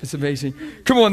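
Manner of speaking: ordinary talk, nothing marked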